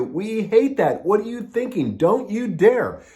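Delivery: a hint of offended user scolding; mock outraged user. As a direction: play an indignant customer pushing back. Users objecting to a change.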